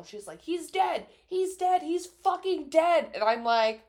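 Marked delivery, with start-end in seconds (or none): 0.4-3.1 imitating her panicked cry, pitched higher; a mild mock of frantic grief; retold hysterical. Friend retells a late-night call, mock hysterical.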